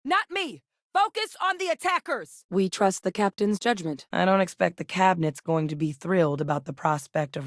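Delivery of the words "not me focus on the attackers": high-pitched battle cry; urgent combat shout. Acted. Fighter redirecting allies toward the attackers, urgent shout. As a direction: yell it out to allies mid-fight.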